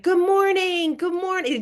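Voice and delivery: high, beaming sing-out with a smile; cheery greeting. Retold or imitated. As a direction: imitate a sunny greeter calling to everyone. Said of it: "she had a huge smile on her face".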